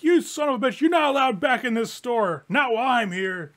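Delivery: high, loud furious yelling; sputtering foiled villain. Acted. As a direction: rage at the thieves leaving. GM voices furious shopkeeper yelling.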